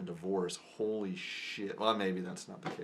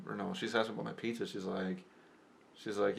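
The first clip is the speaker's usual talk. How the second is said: usual speaking voice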